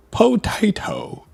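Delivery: high, drawn-out British "potato" as poh-TIE-toh; exaggerated British accent. Shown as a demonstration. Streamer practising for chat, hammed-up British accent. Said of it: "Like that?".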